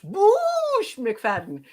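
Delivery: Boosh drawn out slowly and high; hammed-up local thanks; mock Boston accent. Streamer thanks a chatter in a put-on Boston accent. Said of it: "Thank you very much".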